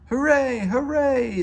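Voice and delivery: loud, high cheer; cheering hooray. A narrator's reading. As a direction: shout it for joy together. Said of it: "the engines whistled".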